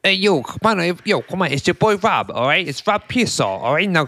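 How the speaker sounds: high, cartoon New Yorker introducing himself; hammed-up New York accent. Host hijacking the absent co-host's intro in a hammed-up New York accent. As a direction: play a mock New York wise guy. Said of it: "Why is the New York accent".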